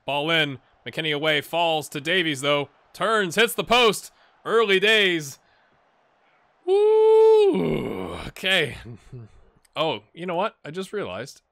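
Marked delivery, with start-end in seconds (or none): 0.0-5.4 louder, higher commentary calling the attack; excited play-by-play. Acted. Gamer calling his own highlights, excited commentary. 6.6-8.1 very high, loud real whoop; excited whoop. Real, not acted. Gamer cheering his striker's shot off the post, whooping.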